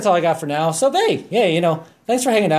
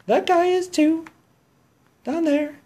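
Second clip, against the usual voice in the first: high squeaky voice; 13 semitones higher than usual. Pretend. High squeaky voice after song.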